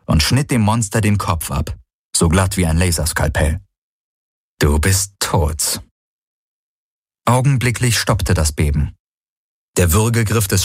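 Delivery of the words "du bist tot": very slow, low and deliberate; final verdict. A narrator's reading. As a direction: deliver it slowly, like a death sentence. Final verdict to beheaded monster. (langsam und tief, endgültig)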